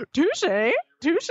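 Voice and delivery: high squeaky mock comeback, rising at the end; squeaked teen impression with an upward flip; breaking adolescent voice. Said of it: "his voice is, like, breaking like he's fourteen".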